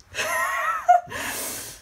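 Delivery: very high giggling squeal; giddy fan laugh. Real, not acted. Recalling the high five.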